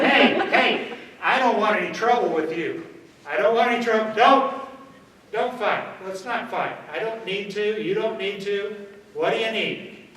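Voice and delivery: loud, high, pleading to back off; loud de-escalation. Shown as a demonstration. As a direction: shout it so everyone nearby hears. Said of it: "a good demonstration of what have I done when I start talking like that".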